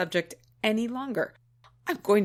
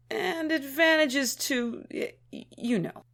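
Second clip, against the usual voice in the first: stammering, trailing into a euphemism; embarrassed hesitation; 5 semitones higher than usual. Acted. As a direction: stumble and falter around the taboo word. Too embarrassed to name tawdry subject.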